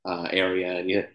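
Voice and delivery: usual speaking voice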